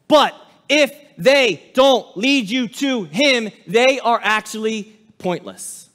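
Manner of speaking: slow, loud emphatic shout; preacher shouting at the congregation, slowed; preaching shout. Real, not acted. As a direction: hammer the point home. Preacher raising voice.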